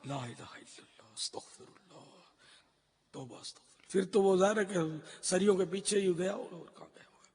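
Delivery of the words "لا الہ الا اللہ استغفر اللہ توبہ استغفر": soft breathy murmur of repentance; barely voiced, to himself; whispered prayer. Real, not acted. Speaker praying under his breath after the sad news, whispered.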